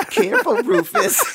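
very high squeaky skillet voice; talking skillet voice. Pretend. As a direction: squeak it out like a tiny pan. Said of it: "cast iron's like".

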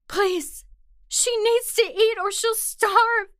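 high, frantic begging; desperate pleading. Acted. The captive begs rescuers to feed her mother, pleading. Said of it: "I kept droning on the same thing".

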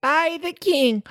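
high, slow herald voice; town crier. Acted. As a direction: proclaim it like a crier.

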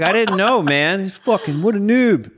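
high, whiny protest; whiny self quote. Retold or imitated. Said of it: "I was like".

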